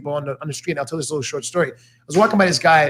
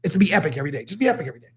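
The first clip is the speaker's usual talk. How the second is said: mock yell; 5 semitones higher than usual. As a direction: fire everyone up, then ease off. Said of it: "I'm the guy screaming at everybody".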